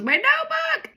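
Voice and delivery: very high, panicked mock wail; hammed-up panicked squeal; freaking out. A narrator's reading. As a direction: shoot the pitch up in alarm. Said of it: "So she starts freaking out".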